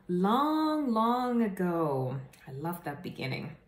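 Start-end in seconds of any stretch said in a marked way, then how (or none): 0.1-2.2 drawn-out fairy tale opening; slow, high storyteller start; storybook opening. Acted. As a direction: stretch it like once upon a time.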